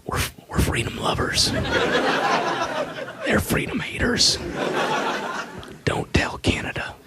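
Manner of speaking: soft unvoiced whisper. Mock president whispering his slogans to the audience. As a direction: breathe it like a conspiracy. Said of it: "he's always, like, whispering that".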